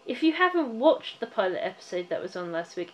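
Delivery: usual speaking voice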